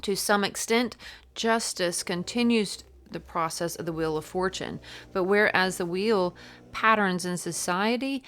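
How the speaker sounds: emphatic, projected book reading; reading aloud. Real, not acted. Tarot reader reading about the Justice card, emphatic read-aloud. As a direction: deliver the passage with punched key words.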